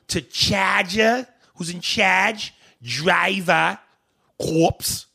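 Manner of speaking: high, exaggerated Boston accent on each word. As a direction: show off a Boston accent, dropping the r's. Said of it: "don't have that accent".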